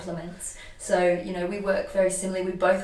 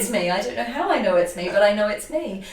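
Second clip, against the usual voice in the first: emotional realization; 4 semitones higher, 8 dB louder, faster than usual. Retold or imitated. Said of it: "she burst into tears".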